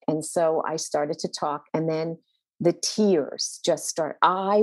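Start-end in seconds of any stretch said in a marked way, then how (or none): none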